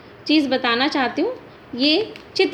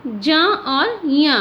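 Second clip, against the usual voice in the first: ज और ञ drawn out very slowly; slow breakdown; much slower than usual. Shown as a demonstration. Teacher to students, breaking ज्ञ down slowly. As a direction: take each letter slowly and clearly. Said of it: "ज्ञ".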